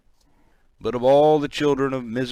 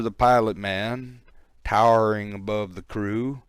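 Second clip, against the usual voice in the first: ordinary delivery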